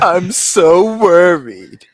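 slow, whiny mock-worried voice; mock worry. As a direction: parody a worried fan. Mocking a worried co-host.

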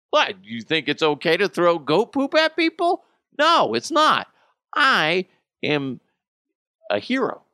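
loud, high mock outrage; hammed-up righteous defiance; mock indignation. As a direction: protest hotly, pitch jumping up. Acted indignant defence of himself.

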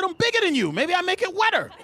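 high, exasperated mock argument; comic squabbling retort; defensive comeback. Arguing back at girlfriend.

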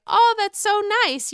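Ebony's sweet reply. Retold or imitated. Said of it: "Ebony was like".

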